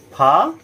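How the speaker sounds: ফা drawn out on its own; separate syllable. Shown as a demonstration. Teacher counting syllables, ফা said alone and long. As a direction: stretch ফা as one standalone beat. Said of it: "দুবারে উচ্চারণ করতে পারছি".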